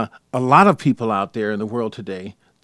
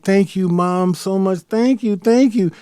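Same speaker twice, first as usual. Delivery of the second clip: higher, eager thanks; grateful gushing; 8 semitones higher, 3 dB louder, slower than usual. Retold or imitated. Son gushing thanks to his mother, higher. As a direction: gush thanks at Mom. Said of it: "And I was like".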